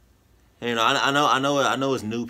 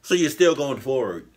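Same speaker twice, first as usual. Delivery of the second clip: ordinary delivery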